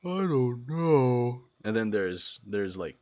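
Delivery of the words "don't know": mock weary sigh into slow words; lame sleep-deprived person. Streamer mocking lame sleep-deprived people, weary. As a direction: act out a worn-out, lame sleepyhead. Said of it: "lame sleep deprived, where the person's just like".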